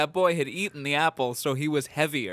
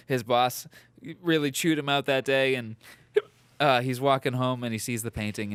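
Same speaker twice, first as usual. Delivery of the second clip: ordinary talk, nothing marked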